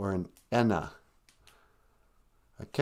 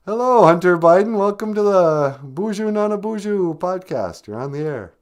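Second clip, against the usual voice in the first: louder, higher mock talk-radio welcome; radio host greeting; 6 semitones higher, 5 dB louder than usual. The host welcomes a pretend caller onto the podcast in radio style.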